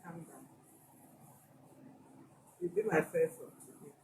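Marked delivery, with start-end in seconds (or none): 0.0-0.4 whispered comfort, much softer; soothing whisper. Real, not acted. Host whispering comfort to the crying wife. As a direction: hush her gently, it's okay.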